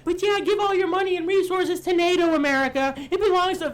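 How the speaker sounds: mocking Europeans. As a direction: mimic smug Europeans who demand American money. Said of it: "They're saying".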